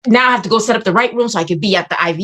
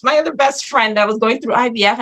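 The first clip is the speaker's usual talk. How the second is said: ordinary talk, nothing marked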